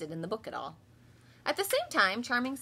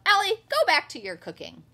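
loud higher bossy yell; sisters yelling; 5 semitones higher, 12 dB louder than usual. Acted. Sisters yell at Ellie to go back to cooking, loud bossy yell. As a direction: bark the order at her sister. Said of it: "Her sisters yelled".

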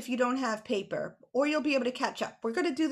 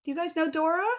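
usual speaking voice

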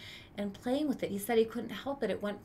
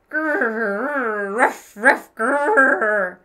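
louder growling ruff ruff; dog growl and bark; 14 dB louder, much slower than usual. Acted. Boy imitating the neighbor's angry dog, growl and bark.

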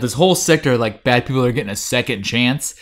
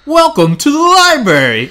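[name] voice; 9 dB louder than usual. Retold or imitated. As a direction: ham up a [name] greeting. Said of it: "in the most Snoop Doggy voice you've ever heard".

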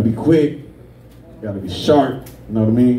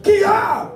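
high, sharp karate kiai; karate yell. Retold or imitated. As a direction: imitate a karate student's striking cry. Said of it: "and she went".

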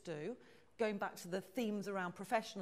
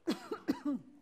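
hard choking cough; real coughing, throat caught; coughing fit; 6 semitones higher, 4 dB louder than usual. Real, not acted. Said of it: "I'm just gonna choke".

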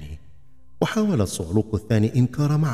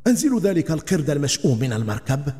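higher, fast, angry yell; angry shout; 6 semitones higher, faster than usual. Acted. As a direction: bark the order to throw the monkey off. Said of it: "وصاح في غضب".

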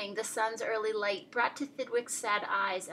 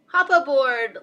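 loud, raised, cheery invitation; hearty storybook call; jolly bug; 4 semitones higher, 10 dB louder than usual. A narrator's reading. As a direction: welcome a newcomer aboard heartily. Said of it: "laughed the bug".

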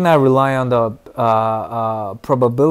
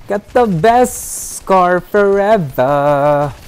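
higher, slow, drawn-out sing-song; stretched sing-song; 5 semitones higher, 5 dB louder, much slower than usual. Real, not acted. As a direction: savour each word, stretched and lilting. Playful stretched repeat of line.

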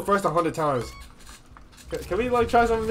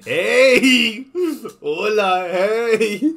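laughing imitation; 3 semitones higher, 6 dB louder, much slower than usual. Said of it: "Anna saying that is funny".

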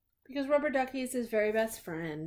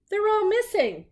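high, loud exasperated complaint; upset Bert; 11 semitones higher, 5 dB louder than usual. Acted. Bert complains to Ernie, high and exasperated. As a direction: wail that everything's gone.

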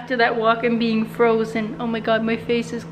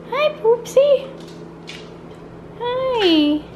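dog-greeting voice; 12 semitones higher, much slower than usual. Real, not acted. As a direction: coo at the dog.

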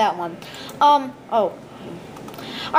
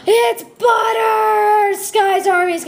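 excited shout; 9 semitones higher, 6 dB louder, much slower than usual. Real, not acted. Kid finds butter in the game.

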